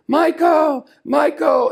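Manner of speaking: high, loud call across a corridor; high loud call of the name, twice. Retold or imitated. Calling out down a corridor.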